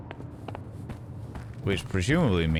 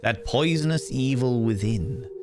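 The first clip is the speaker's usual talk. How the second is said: dramatic, higher-pitched storybook reading; theatrical story narration; 3 semitones higher than usual. Acted. Player reads out chapter five of collected stories, theatrical narration. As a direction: read it like a portentous rhyming tale.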